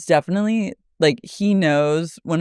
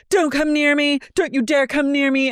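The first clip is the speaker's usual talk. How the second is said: higher, warning imitation; mock Lisa; 8 semitones higher than usual. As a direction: warn her off like a rattled Lisa. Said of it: "And Lisa goes".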